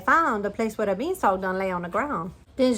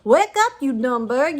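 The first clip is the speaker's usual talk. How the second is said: slow, louder angry bark; giant shout; 4 semitones higher, 6 dB louder, slower than usual. A narrator's reading. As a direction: roar at the hen. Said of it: "he shout".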